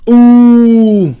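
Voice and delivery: a sudden high, loud squeal of delight; excited high ooh. Pretend. Podcaster riffing on a street-racing movie, high excited ooh. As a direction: squeal like a thrilled street racer. Fast and Furious tangent.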